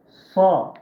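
Sa said thick and loud, tongue pulled back; heavy sa. Shown as a demonstration. Teacher demonstrating the thick Arabic letter sa. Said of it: "kalın".

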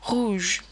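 rouge with a French throat R. Shown as a demonstration. Teacher demonstrating R, rouge with French R. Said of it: "There is no similar sound in English".

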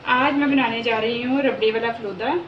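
ordinary talk, nothing marked

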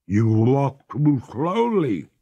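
freezing ghost. Acted. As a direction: complain through the cold, slow and high.

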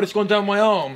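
ordinary talk, nothing marked

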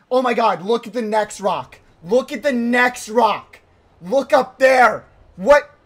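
high, loud panic; alarmed disbelief. Real, not acted. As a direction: cry out in real disbelief at the climb. Alarmed at next rock in game.

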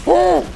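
high, loud "oh" yelp; startled cry. Real, not acted. Gamer mid-fight after saying all is fine, startled yelp. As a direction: cry out in sudden shock. Sudden mishap in the game.

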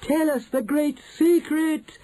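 very high, drawn-out plea; calling out. A narrator's reading. Witches begging for the great secret, calling out. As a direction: beg high and slow for the secret. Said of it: "they called out".

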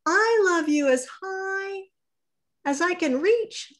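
high and reaching, straining upward; little hare stretching up, very high pitch. Acted. Little hare to his father, high straining voice. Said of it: "said Little Nut Brown Hare".

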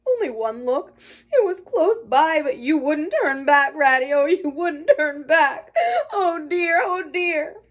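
high, loud tearful wailing; sobbing brokenly. A narrator's reading. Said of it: "he sobbed forth at last brokenly".

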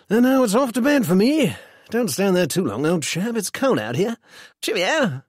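high, loud-starting cheery farewell; breezy Mungo. A narrator's reading. Mungo leaves his friend in the street, breezy. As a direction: toss off a breezy goodnight, pitch up.

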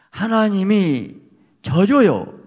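loud, high and slow, 하나님이 stretched and falling; preaching climax. Real, not acted. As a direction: hammer the point home slowly. Climax of the sermon point. (설교 절정에서 크고 느리게 외치듯)

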